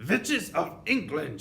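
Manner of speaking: slow, high, thundering witch cry; Grand High Witch roaring for attention; commanding shout. A narrator's reading. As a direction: bellow it slowly like a witch ruling her coven. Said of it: "she yelled".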